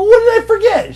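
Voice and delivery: high, loud, incredulous question; exasperated comeback. Retold or imitated. Waiter retelling a row with a guest, exasperated.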